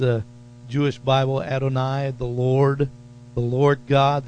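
normal talking voice

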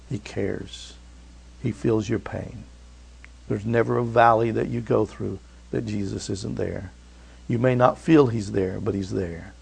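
soft, low consoling voice; hushed comfort. Real, not acted. Pastor consoles people in pain, hushed and tender. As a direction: keep it near a hush, like at a bedside.

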